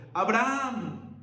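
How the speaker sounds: drawn-out loud call of a name; the angel's urgent summons; calling out from heaven. Acted. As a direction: call out to someone far away, stretching the name. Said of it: "le gritó desde el cielo".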